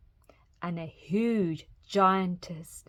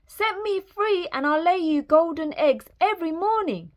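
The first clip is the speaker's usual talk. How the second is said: high-pitched pleading hen; clucking hen; 9 semitones higher than usual. A narrator's reading. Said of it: "she clucked".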